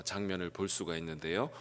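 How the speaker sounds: ordinary talk, nothing marked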